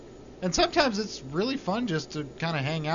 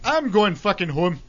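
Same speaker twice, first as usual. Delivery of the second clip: put-on Irish accent; 5 semitones higher, 7 dB louder than usual. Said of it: "I slip into an Irish accent".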